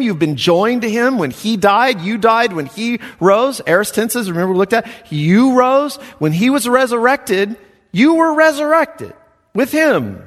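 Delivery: preacher building to a peak; rising preaching. Acted. Preaching union with Christ.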